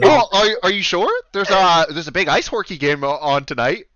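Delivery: eager Canadian innkeeper, starting high and loud; Canadian accent. Acted. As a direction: coax a guest in a Canadian accent. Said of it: "deal with the accent".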